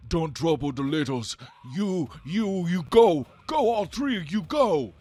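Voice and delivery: high, loud, simple-spoken giant voice; protective snow giant. Acted. Snow giant defends the littles from three boys, loud command. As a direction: order them off in a big, high giant voice.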